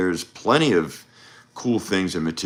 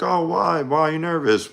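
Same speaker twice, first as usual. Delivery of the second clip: much higher-pitched mock girl voice; imitated girl; 10 semitones higher than usual. Girl asks a nervous suitor why, high imitated voice. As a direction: play her reply in a high, put-on voice. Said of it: "And then she's like".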